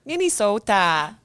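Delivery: loud, hammed-up Minnesotan accent. Shown as a demonstration. Co-host asked for a redo, performs Minnesotan accent. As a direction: lean hard into the Minnesotan long O. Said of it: "a redo of your Minnesotan accent".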